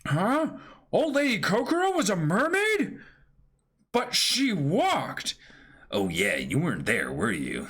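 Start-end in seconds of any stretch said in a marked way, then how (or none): 0.1-5.3 high shocked disbelief. Acted. Sanji learns Kokoro is a mermaid, high shock. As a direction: sound floored by the news.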